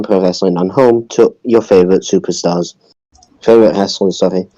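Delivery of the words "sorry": ordinary talk, nothing marked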